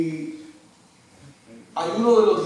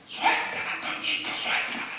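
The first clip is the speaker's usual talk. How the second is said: softer whispered aside to a friend; retold whisper; 10 dB quieter than usual. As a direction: whisper it conspiratorially to Toñito. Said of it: "fui donde Toñito y le dije". (susurro a Toñito para que la suelte)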